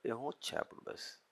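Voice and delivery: breathy whisper on the punchline; hushed, knowing whisper; whispered aside. Acted. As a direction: drop to a whisper, confiding the point. Whispered punchline about the ego.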